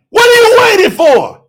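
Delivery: high, loud demanding question; preaching shout. Real, not acted. Preacher challenging listeners who delay baptism, loud high shout. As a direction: hit YOU hard and demand an answer.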